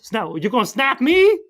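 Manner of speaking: high-pitched mock Puss in Boots accent; indignant question in a put-on Puss in Boots accent. Puss challenging the masked cat, in a put-on accent. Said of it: "you're doing a great accent".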